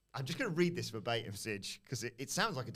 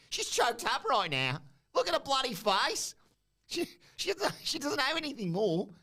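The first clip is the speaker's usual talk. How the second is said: much higher, louder and gleeful; squeaky mockery; 13 semitones higher, 4 dB louder than usual. Champion mocking the silent interviewer, a high squeaky gloat. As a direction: cackle it out like a bratty bully.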